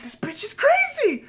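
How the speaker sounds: high, slow, amazed; mock stunned disbelief. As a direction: say it with wide-eyed disbelief. His stunned reaction.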